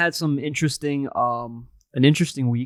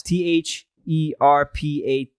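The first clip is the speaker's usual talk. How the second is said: spelled out letter by letter; slow deliberate spelling; 3 semitones lower, slower than usual. Shown as a demonstration. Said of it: "And then spell it".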